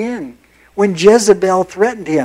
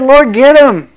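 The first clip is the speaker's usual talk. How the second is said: louder, higher plea to strike them; angry demand; 4 semitones higher, 6 dB louder than usual. Acted. Elijah demanding God punish Israel, angry shout. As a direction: spit it out at God, furious. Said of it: "He said".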